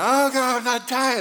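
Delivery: high, loud panic; panicked kid. Retold or imitated. Bloody-nosed boy panicking, high and loud. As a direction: squeal it like a scared first grader. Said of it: "And I'm like".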